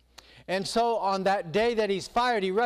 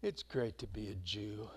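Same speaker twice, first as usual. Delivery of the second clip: mock contented sigh; 8 semitones lower, 11 dB quieter than usual. Voicing proud sigh.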